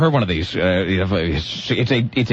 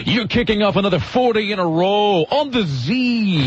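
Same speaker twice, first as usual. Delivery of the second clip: higher, punchy station-sweeper voice; mock an over-the-top eighties DJ; cheesy radio announcer; 6 semitones higher than usual. Host imitating an eighties station voice-over, hammed-up announcer. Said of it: "a guy over the top of it going like".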